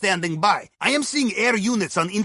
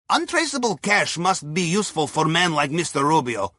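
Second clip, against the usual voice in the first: usual speaking voice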